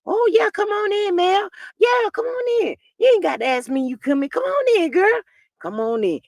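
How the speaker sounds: mock-sweet invitation; eager welcome. Martell sweetly inviting Mel in, mock eager. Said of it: "That's how come he let Mel in".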